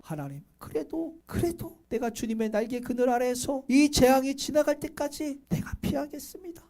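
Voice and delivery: soft, subdued plea to God; acted quiet resolve under threat; hushed prayer. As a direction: drop to a quiet, trusting prayer. Acting David's prayer under threat.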